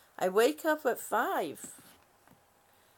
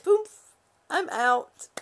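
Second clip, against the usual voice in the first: poof popped out high and loud; playful poof, much higher; sudden poof; 7 semitones higher, 6 dB louder, much slower than usual. Retold or imitated. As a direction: make the sound of switching off. Describes dozing off suddenly.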